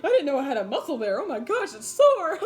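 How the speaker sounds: high, laughing mock moan about aching muscles; laughing complaint. Telling viewers how sore she felt, laughing. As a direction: giggle through a hammed-up complaint of soreness. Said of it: "It's like".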